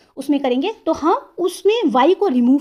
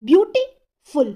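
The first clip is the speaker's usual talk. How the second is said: Beautiful slowly, broken after beauty; teacher sounding out beautiful in two parts; pause between syllables; much slower than usual. Shown as a demonstration. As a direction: split beautiful in two with a clear gap. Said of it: "pause आता है।".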